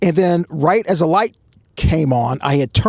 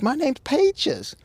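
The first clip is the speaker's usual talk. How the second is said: coy woman; 13 semitones higher, 4 dB quieter, slower than usual. Retold or imitated. Voicing the flirty woman.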